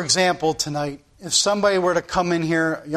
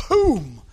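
a quick punchy boom for the rams' crash; imitated boom; 3 semitones higher than usual. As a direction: sound out two rams colliding. Imitates rams butting heads.

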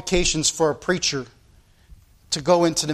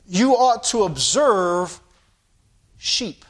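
slow, deliberate emphasis; much slower than usual. Real, not acted. Slow emphatic exhortation to the congregation.

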